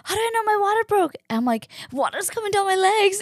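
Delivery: pleading little sister voice; asking her sister, voice way up; anxious high. Retold or imitated.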